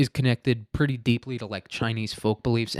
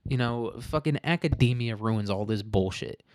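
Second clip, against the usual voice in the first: usual speaking voice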